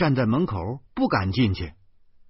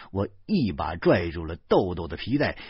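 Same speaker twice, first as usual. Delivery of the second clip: ordinary delivery, nothing marked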